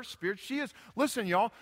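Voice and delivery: normal talking voice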